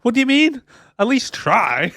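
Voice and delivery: high, loud disbelief; mock incredulous protest. Mock protest at driver giving up.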